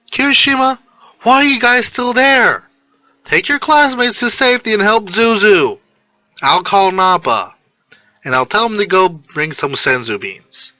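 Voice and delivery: high, loud and urgent; urgent girl's orders. Acted. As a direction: command the boys to leave, then ease off. Neji ordering on phone.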